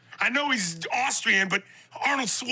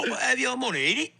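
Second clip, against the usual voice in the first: mock British accent. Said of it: "I go".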